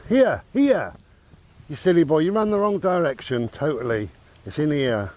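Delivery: loud, high call to a dog. Real, not acted. Owner calling her dog that ran the wrong way, loud and high.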